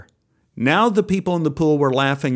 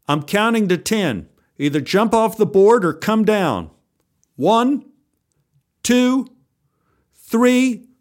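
shouted countdown; 7 semitones higher than usual. Acted. Lifeguard's shouted ultimatum and countdown.

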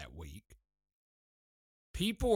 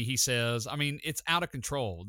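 usual speaking voice

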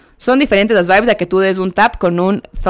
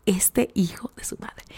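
breathy whisper, barely there; whispered disbelief; 11 dB quieter, slower than usual. Real, not acted. To the viewers about Jeremy, a seething whisper. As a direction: whisper it like a secret curse.

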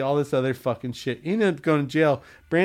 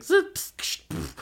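high zips then hissed pff sounds; imitated noises. Man imitating noises that break his focus, zipping and hissing. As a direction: spit out little buzzing noises.

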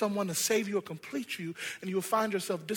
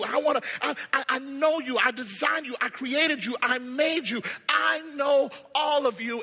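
high, forceful preacher's delivery; impassioned preaching; 5 semitones higher, 4 dB louder than usual. Real, not acted. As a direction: preach it with rising urgency.

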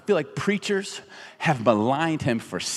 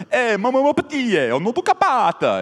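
a hammed-up fake Italian accent, pitched higher and louder; mock Italian accent; 8 semitones higher, 3 dB louder than usual. Tourist walking around Italy, mock Italian accent. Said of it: "I would walk around Italy being like".

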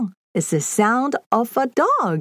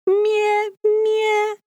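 high bleating mie; sheep bleat; 10 semitones higher than usual. Shown as a demonstration. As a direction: bleat like a little lamb. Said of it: "That's the sound of a sheep".

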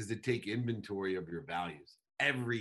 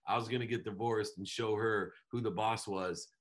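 ordinary delivery

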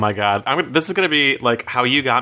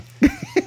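high laugh. Real, not acted. Laughing at own joke.

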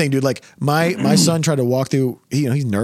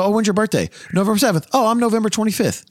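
higher, chirpy put-on chitchat; mocking small talk; 9 semitones higher than usual. Mocking people comparing birthdays, chirpy. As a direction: parody eager birthday talk.

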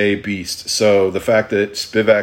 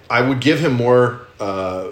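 usual speaking voice

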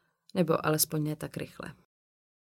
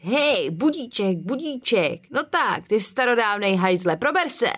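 put-on drunken yelling; 4 semitones higher, 4 dB louder than usual. Tom yelling at the coffin.

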